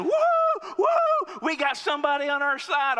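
very high whooping cheer; left-wing fans cheering their pick. Retold or imitated. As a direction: cheer like the side just scored. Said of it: "start shouting".

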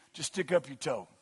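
usual speaking voice

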